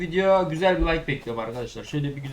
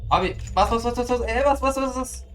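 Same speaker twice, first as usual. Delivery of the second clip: high, rapid-fire panicked urging; gamer's high-pitched frenzy mid-attack; frantic urging; 9 semitones higher, 4 dB louder than usual. Real, not acted. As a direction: urge it on in a raised, frantic voice. Frantically urging button press in game.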